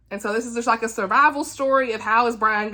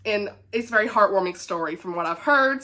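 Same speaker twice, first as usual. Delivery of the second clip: ordinary delivery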